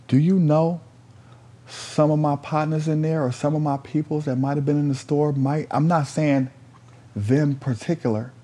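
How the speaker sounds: high, insistent reasoning; retold indignant appeal. Storyteller asking the man about people at the border, urgent.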